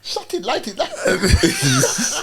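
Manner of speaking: high chanted yardie hook, laughing; Jamaican accent. Retold or imitated. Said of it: "I'm doing the yardie thing".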